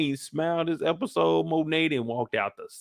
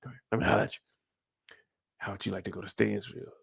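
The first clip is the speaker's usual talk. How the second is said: sly hushed pitch; 5 semitones lower, 10 dB quieter, faster than usual. Retold or imitated. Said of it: "talking about".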